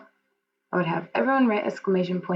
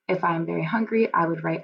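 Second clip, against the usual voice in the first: usual speaking voice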